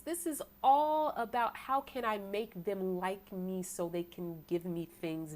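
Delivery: ordinary talk, nothing marked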